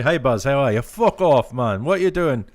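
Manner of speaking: put-on loud, high-pitched go at a Geordie accent; attempted Geordie accent. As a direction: do a thick Geordie, badly, at full volume. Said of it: "trying to do a British accent".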